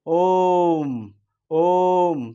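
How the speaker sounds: ओम held long on the ओ; prolonged ओम. Shown as a demonstration. Teacher showing the long pluta vowel, prolonged ओम. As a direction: stretch out ओम like calling. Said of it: "उच्चारण में ज़्यादा से ज़्यादा समय लग रहा है".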